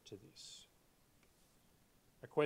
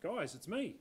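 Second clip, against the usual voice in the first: higher and louder, a cheery hey guys; breezy call; 8 semitones higher, 4 dB louder than usual. Pretend. Pretend Jesus breezily greeting fishermen in boat, bright and higher. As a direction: toss it off like a mate turning up at work. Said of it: "He could have just turned up and said".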